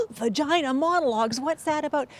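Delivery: gasp, then mock-scandalized questioning; mock shocked viewer. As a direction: play an audience member taken aback, with a gasp first. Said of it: "some of you out there saying".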